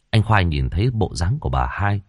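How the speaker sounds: usual speaking voice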